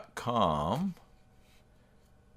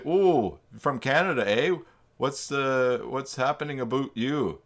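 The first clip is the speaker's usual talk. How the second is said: high, hammed-up TV Canadian accent, more Minnesotan; mock Canadian accent; 5 semitones higher, 5 dB louder than usual. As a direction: make fun of a Canadian accent, pitched up. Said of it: "that sounds more like a Minnesota accent".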